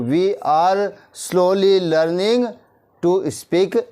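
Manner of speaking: very slow, each word spaced out for learners; slow dictation. Shown as a demonstration. Teacher to Hindi-speaking class, slow clear English model sentence. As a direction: spell it out slowly so the class can follow. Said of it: "क्या बोला मैंने?".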